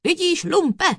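high, loud triumphant thunder; loud judge's outburst. A narrator's reading. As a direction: thunder it at the scoundrel. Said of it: "zahromoval soudce na kouzelníka".

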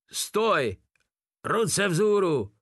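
high, drawn-out challenge; excited holler. A narrator's reading. Gregor challenges a figure in the trench, excited holler. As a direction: call out a halt, pitched high. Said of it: "houkl vzrušeně Gregor".

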